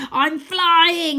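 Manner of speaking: high, loud triumphant shriek; Croaky shrieking as he takes flight. A narrator's reading. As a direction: screech with wild joy. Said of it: "he shrieks".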